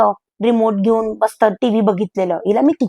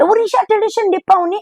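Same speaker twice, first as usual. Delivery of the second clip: mocking sing-song; 9 semitones higher than usual. Maid mocking weekend guests.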